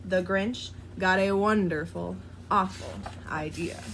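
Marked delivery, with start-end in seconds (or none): none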